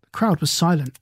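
plain reading voice

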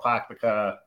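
"Pakpaka" with dropped Boston R's; Boston accent. Shown as a demonstration. Going back to Lynn, lapsing into Boston accent. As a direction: drop the R's, Boston style. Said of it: "the Boston accent".

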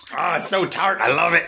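a lightly voiced tart-loving taster; lip-smacking taster. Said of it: "smacking his lips like".

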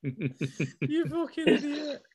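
laughing through the insult; cracking up mid-word. Real, not acted. Laughing at friend's joke.

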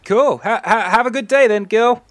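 very high, loud character call; shouted farewell. Acted. Tavern keeper seeing Gil off, high shouted goodbye. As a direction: call out a stammered goodbye at full pitch.

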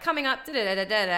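upbeat radio-link delivery timed to the intro. Shown as a demonstration. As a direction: talk up to the vocal like a DJ. DJ talking up to the vocals.

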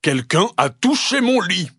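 gruff papa bear growl; grumbling big voice. Acted. Papa bear opening the bedroom, big growling voice. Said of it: "grogne de sa grosse voix".

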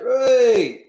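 high, loud jeering whoop; mock cheer. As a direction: whoop like a dressing room of lads mocking the boss. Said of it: "all go".

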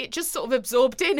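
usual speaking voice

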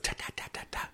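quick unvoiced panting whisper of a little bird; sparrow panting. Imitating the gasping sparrow, a panting whisper. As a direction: pant like a tiny bird with its beak open. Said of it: "he was breathing like".

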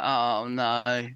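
low, fed-up moan; weary groan. Retold or imitated. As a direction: groan it out, exhausted. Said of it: "I was like".

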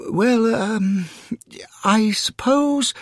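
high, halting, wavering agreement; reluctant gentleman giving in. Acted. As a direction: agree hesitantly, drawing out the last word. Reluctant newcomer gives in.

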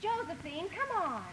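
far-off, faint impatient call; distant calling. Acted. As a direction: call a lagging sister from up the road. Sister calls from up the road.

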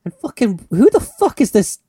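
hot-headed outburst, half joking; mock outrage. Real, not acted. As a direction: rant at the weirdo she married. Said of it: "Bit aggressive. Coming out hot".

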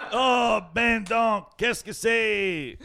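high, loud, laughing Québécois exclamation; drawn-out mock French-Canadian outcry; mock Québécois shout. Mocking a Quebec customer's surprise at coins, in a loud mock Québécois accent. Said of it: "and they're like".

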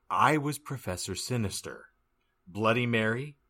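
ordinary talk, nothing marked

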